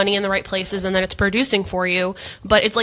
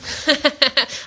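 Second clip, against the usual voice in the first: light, higher-pitched chuckle; amused laugh; 7 semitones higher than usual. Real, not acted. Co-host reacting to host's doubt, amused laugh. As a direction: chuckle at being caught out.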